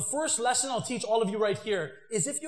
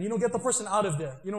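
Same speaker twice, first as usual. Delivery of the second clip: usual speaking voice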